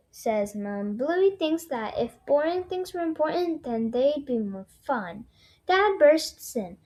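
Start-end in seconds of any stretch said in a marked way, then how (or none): none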